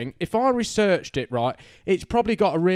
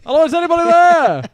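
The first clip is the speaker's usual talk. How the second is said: high, loud, hammed-up ghost-hunter hail; mock calling out; 11 dB louder, faster than usual. Mock ghost hunter calling to spirits in the building, loud high call. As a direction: holler for any spirits in the building.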